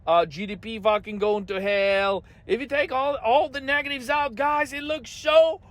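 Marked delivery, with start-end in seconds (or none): 2.4-5.6 mock spin. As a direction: stretch the last words like a fake cheerleader. Parodying Navarro's message.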